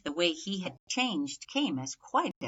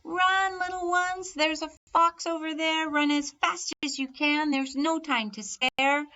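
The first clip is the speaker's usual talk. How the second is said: high, loud, urgent cry to the little ones; mother hen shrieking a high alarm to her poults; frantic warning shout; 9 semitones higher, 3 dB louder than usual. Acted. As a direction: scream at the chicks to run for their lives. Mother hen warns poults of fox.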